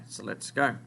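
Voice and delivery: ordinary talk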